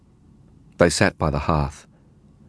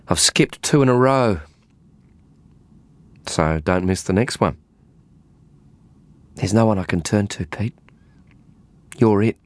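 put-on lightly higher young woman's voice; Faye's voice; 4 semitones higher than usual. A narrator's reading. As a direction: voice her tense and pleading. Narrator voices Faye.

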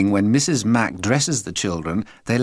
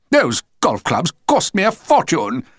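louder, higher outraged father; angry shout; 7 semitones higher, 5 dB louder than usual. A narrator's reading. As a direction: bluster about the money. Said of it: "he shouted".

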